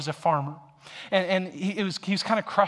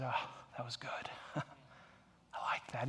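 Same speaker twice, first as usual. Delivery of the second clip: retold whisper; 4 semitones lower, 14 dB quieter, slower than usual. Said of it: "and he said".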